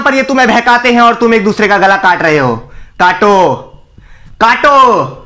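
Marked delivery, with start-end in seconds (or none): 4.4-5.1 angry goading. Acted. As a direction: goad them with a fierce, drawn-out काटो. Bitterly goads crowd to kill. (गुस्से में ललकार)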